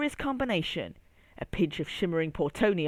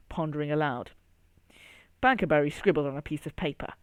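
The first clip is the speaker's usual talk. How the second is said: usual speaking voice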